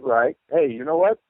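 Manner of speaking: ordinary delivery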